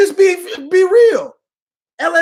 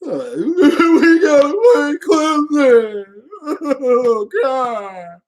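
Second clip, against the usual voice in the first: mock sob-laugh; much slower than usual. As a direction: ham up the coach's sobbing. Mock coach cries again.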